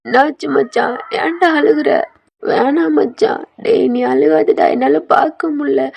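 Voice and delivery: loud, high, slow anguished pleas; acted cry of distress, drawn out; tearful pleading. A narrator's reading. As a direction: beg a weeping friend to stop, close to crying yourself. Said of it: "அவனும் அழுகுறான்".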